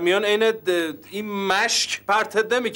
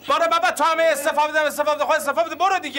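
exasperated boss; 6 semitones higher, faster than usual. Acted. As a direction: wave him off in irritation. Boss snaps at resigning colleague. (با کلافگی و تمسخر، صدای بلندتر)

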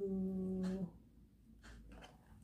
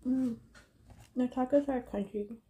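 talking while chewing; 2 semitones higher, 3 dB louder than usual. Real, not acted. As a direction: mouth partly full. Seen 2: Ate with fork at -5; lips pursed, chewing; box later hides mouth.

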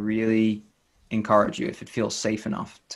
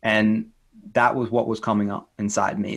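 usual speaking voice

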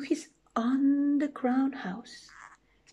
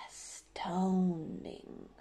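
very soft, stretched-out wonder; hushed awe; 3 semitones lower, 10 dB quieter, much slower than usual. Acted. Gruffalo astounded by the mouse, slow whispered awe.

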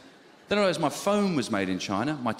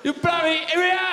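high mock Chinese shout; hammed-up Chinese accent, shouting; angry shout; 7 dB louder than usual. Meerkat ad parody, shouted.

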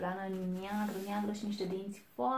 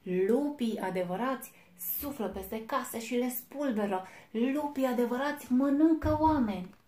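building louder and higher to a roar; wolf roaring; 4 semitones higher, 9 dB louder than usual. A narrator's reading. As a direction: boast bigger and bigger until it booms. Big wolf boasting to little wolf.

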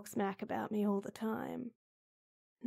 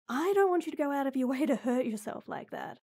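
normal talking voice

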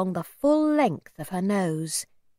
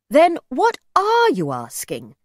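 slow, higher, louder challenge; impatient demand; 5 semitones higher, 6 dB louder than usual. A narrator's reading. Mother presses the old man, impatient. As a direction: press him hard, leaning on the question.

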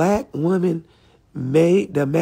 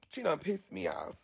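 ordinary talk, nothing marked

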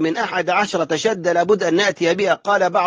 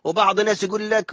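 ordinary delivery

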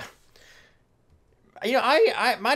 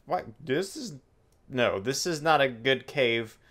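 ordinary delivery

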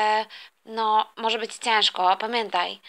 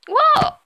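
put-on much higher, louder child whoop; delighted squeal; 7 dB louder than usual. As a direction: squeal as you land on the bed. Thrown onto the bed.

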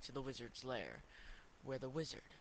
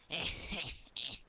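mocking laugh; 3 semitones higher, 4 dB louder than usual. Boss laughs at potato.

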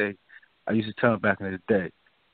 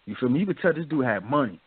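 normal talking voice

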